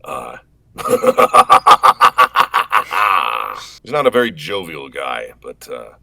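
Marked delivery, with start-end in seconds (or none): 0.8-3.8 long, louder dark chuckle; evil laugh. Shown as a demonstration. Chat asks for an Omen laugh, dark laugh. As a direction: laugh like a brooding phantom. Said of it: "Can you do an evil laugh".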